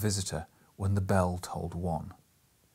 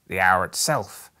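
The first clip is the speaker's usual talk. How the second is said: louder, ringing out in triumph; triumphant; 2 semitones lower, 8 dB louder than usual. Acted. Scrooge to himself, proud he counted right, triumphant. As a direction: crow it like a man who beat the clock. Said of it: "said Scrooge triumphantly".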